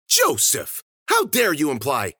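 high, loud outraged gasp of a name; shocked, theatrical reproach; indignant scolding. Acted. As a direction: scold a companion, starting very high. Susie scolds an imagined companion.

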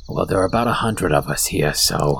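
low, matter-of-fact kobold voice; kobold leader. Acted. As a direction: drop the pitch and state it casually.

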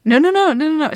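high, loud, rushed string of no's; flustered, caught off guard; panicked, embarrassed no's; flustered denial. Acted. Flustered by the date question.

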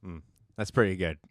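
ordinary talk

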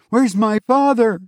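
put-on very high child's voice; demanding boy. A narrator's reading. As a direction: ask it high, like a boy wanting his mother. Boy demands his father.

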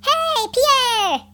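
high, drawn-out storybook yell. A narrator's reading. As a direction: holler across to a far ship. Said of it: "Ryan yelled out".

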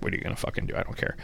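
whispered imitation of Alfred Molina shrugging it off; hushed, careless retort; mock dismissive whisper. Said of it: "Alfred Molina's like".

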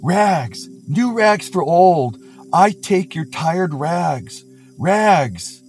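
street vendor's call. Acted. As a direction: stretch each word like a ragman hawking wares. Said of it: "calling in a clear tenor voice".

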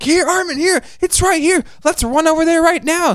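high, hammed-up rushed Connie; hammed-up urgency. Connie fakes urgency to Armin, high hammed-up voice. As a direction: overact the hurry. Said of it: "Connie's like".

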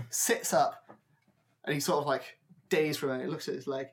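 ordinary talk, nothing marked

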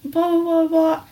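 high, loud mocking mom rant; exaggerated imitation of the yelling mother; mocking mom's yelling. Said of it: "Yelling at the top of her fucking lungs".

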